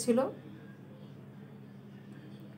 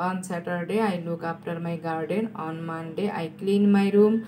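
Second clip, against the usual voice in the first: very slow, measured reading; slow dictation; much slower than usual. Real, not acted. Teacher repeating answers for students to write, slow dictation. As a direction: dictate each sentence slowly, pausing between phrases. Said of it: "আমি আস্তে আস্তে বলছি".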